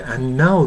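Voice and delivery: "and now" as an-now, d absorbed by the n; everyday "and now" without a d; swallowed d. Shown as a demonstration. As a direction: drop the d and let the n carry "and now". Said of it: "nuのに飲み込まれて".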